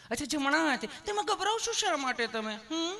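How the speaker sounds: very high, sugary nurse voice; hammed-up tender nurse; loving nurse. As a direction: coax a nervous patient sweetly. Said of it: "પ્રેમથી પૂછો છો".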